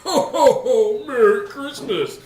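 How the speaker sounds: high, loud Santa greeting. Acted. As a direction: boom out a Santa Christmas cheer. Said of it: "You sound like a dying child".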